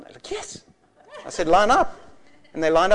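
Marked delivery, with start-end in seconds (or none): 0.3-0.6 put-on tiny high child voice; soft, high-pitched mimicked yes; eager kids. Children answering the preacher's offer, eager kids. 1.5-2.0 put-on loud, raised call to kids. Preacher telling eager kids to queue for prayer, calling out. Calls the children to line up.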